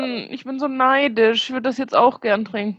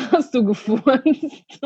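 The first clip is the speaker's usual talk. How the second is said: laughing; much slower than usual. Real, not acted. Laughing accusation.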